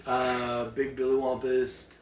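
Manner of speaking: ordinary talk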